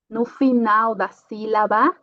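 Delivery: normal talking voice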